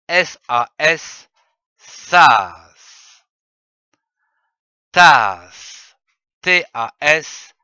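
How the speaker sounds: put-on loud, slow, punched syllables for children to repeat; dictation drill. Teacher leads children reciting syllables, loud and deliberate. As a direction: hammer each syllable out like a teacher calling a drill.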